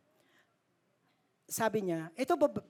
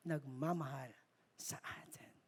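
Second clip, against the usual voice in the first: barely breathed, fading out; faint whisper; 5 semitones lower, 10 dB quieter than usual. Real, not acted. Preacher whispers God's love for us to the congregation. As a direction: let the words vanish into a whisper. Emotional close of thought.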